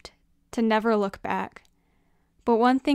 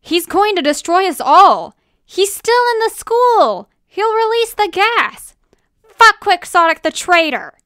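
high, loud panicked cries; frantic, full-volume yelling; frightened shouting; 9 semitones higher, 11 dB louder than usual. Acted. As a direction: shout in alarm like a cornered villain. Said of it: "the shouts of frightened villains".

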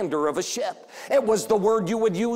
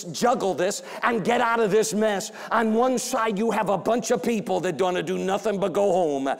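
ordinary talk, nothing marked

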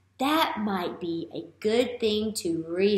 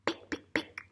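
soft whispered pick pick pick like a chick tapping its shell; whispered pecking sound; 7 dB quieter than usual. Acted. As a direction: tap out the pecking in a tiny whisper. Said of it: "That's an example of onomatopoeia".

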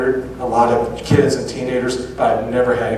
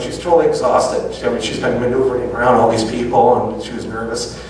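normal talking voice

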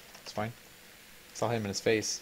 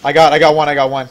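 excited; 3 semitones higher, 12 dB louder than usual. Real, not acted. Excited kill callout.

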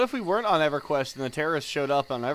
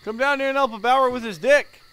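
calling out; 4 semitones higher, 4 dB louder than usual. Acted. Calling out to Vic.

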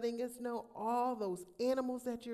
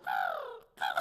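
excited gasp. Real, not acted. Excited gasp at bird sounds.

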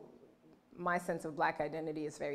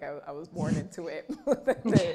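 laughing; 8 dB louder than usual. Real, not acted. Laughs at being born into it.

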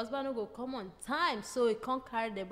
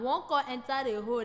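ordinary talk, nothing marked

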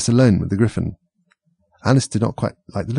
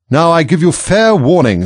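high, loud imperious Queen; raised, commanding royal shout; shouted warning; 8 semitones higher, 8 dB louder than usual. A narrator's reading. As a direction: thunder it out as an angry Queen. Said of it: "shouted the Queen".

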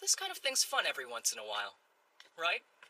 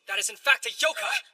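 loud, high stage declamation; projected theatrical alarm, rising; dramatic warning; 9 semitones higher, 7 dB louder than usual. Acted. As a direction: warn them urgently of the monster. Stage warning about a monster.

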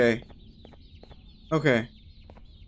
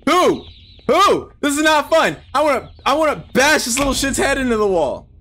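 higher-pitched, fed-up outburst; exasperated rant; 5 semitones higher than usual. Real, not acted. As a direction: rail at whoever designed this. Rant at game designers.

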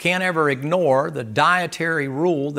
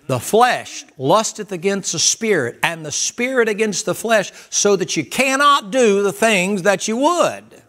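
slow, raised scripture proclamation; scripture reading; 6 semitones higher, 4 dB louder than usual. Acted. Preacher reading Galatians to the congregation, slow and loud. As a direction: proclaim the verse with weight.

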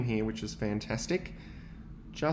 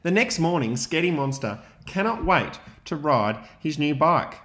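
put-on lively read-aloud for young children; story narration; 6 dB louder than usual. A narrator's reading. Teacher reading to his class, lively storytelling. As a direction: read the picture book to the class with energy.

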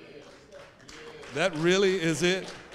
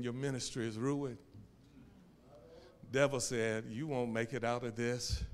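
low, soft, a hint of a menacing devil; the devil's voice; 7 semitones lower, 12 dB quieter than usual. The devil taunting the preacher in his grief, low. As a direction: murmur it like a lightly voiced tempter. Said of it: "The devil was saying".

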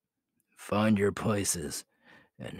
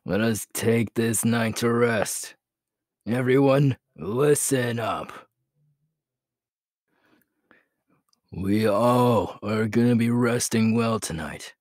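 calling out to the pack; 4 semitones higher, 6 dB louder than usual. Acted.

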